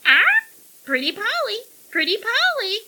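high, loud squawked coaxing; shrill coaxing bird call; coaxing parrot. A narrator's reading. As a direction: screech it like a parrot wheedling. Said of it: "the bird coaxed".

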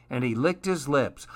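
ordinary delivery, nothing marked

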